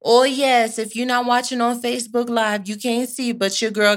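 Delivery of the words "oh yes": excited oh yes. Real, not acted.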